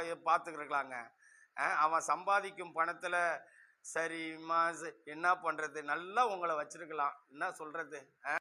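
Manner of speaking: low, soft sweet-talk to the wife; flattering the friend's wife on the sly, soft and low; sly quiet aside. Acted. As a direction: murmur it so the husband won't hear.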